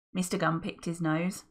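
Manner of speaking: ordinary delivery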